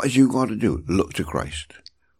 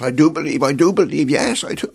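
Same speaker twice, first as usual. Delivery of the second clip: louder, rising to a high yes; fervent cry; 5 semitones higher, 4 dB louder, faster than usual. Retold or imitated. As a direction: cry it out, pleading with faith. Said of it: "his heart that's speaking".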